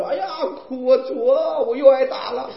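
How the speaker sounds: high-pitched imitation of crying prayer; mock tearful wailing. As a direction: wail it like a woman crying to God, hammed up. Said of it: "她成天跪在那儿哭". (模仿跪着哭诉)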